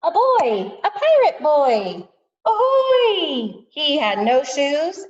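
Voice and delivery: high, loud storybook excitement; excited reveal. A narrator's reading. Teacher revealing the new pirate neighbor to kindergartners, excited. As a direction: announce it big, like a thrilling surprise.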